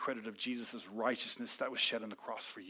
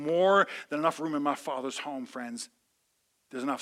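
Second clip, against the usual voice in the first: ordinary talk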